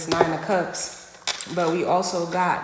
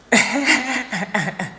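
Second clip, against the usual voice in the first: higher-pitched burst of laughter; 4 semitones higher, 4 dB louder than usual. Real, not acted. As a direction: laugh out loud, tailing downward. Laughs to herself mid-reading.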